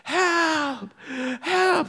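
very high, thin drowning cry; re-enacted high-pitched plea for rescue; mock screaming for help. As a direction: imitate a kid yelling from the waves, high but not full volume. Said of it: "just screaming".

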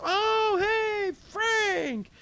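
very high, bright, loud greeting; Peter greeting. Acted. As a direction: Peter welcoming an old friend with delight. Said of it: "Peter turns around and sees them and goes".

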